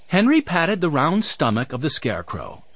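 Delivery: ordinary delivery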